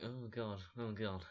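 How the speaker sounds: hushed, dismayed oh God; a quiet gasp of dread; lightly acted muttered dismay. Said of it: "got the look on his face like".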